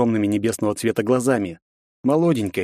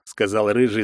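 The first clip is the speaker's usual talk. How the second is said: usual speaking voice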